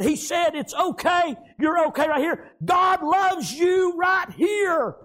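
high, loud and slow; shouted proclamation. Retold or imitated. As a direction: preach the nurse's words. Nurse's note proclaimed.

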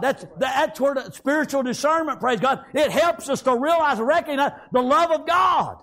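high, driving pulpit delivery; impassioned preaching. Real, not acted. Preaching at full force.

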